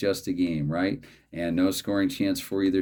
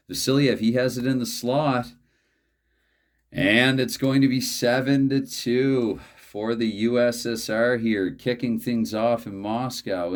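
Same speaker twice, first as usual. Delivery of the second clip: higher-pitched goal call, slowing as it goes; play-by-play goal call; 4 semitones higher, 4 dB louder than usual. Acted. Commentator announcing a Soviet goal in Moscow, drawn-out play-by-play call. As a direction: call the goal, stretching out the score.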